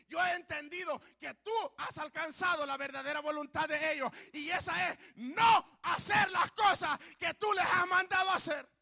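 rising to a full shout; shouted prayer. Retold or imitated. Preacher retelling his prayer, building to a shout. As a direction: cry it out to God, building.

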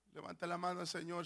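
ordinary talk

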